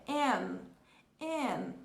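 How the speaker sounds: "N" com fechamento completo; "N" concluído, língua no céu da boca; full English N. Shown as a demonstration. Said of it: "a letra N e a letra M em inglês". ("N" pronunciado por inteiro)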